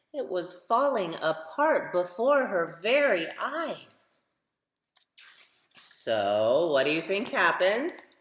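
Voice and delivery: lively picture-book read-aloud for little ones; reading to children. A narrator's reading. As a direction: tell it like story time on the rug, rising with the refrain.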